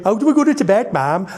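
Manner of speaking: Nigel voice. Retold or imitated. Nigel asking his mum.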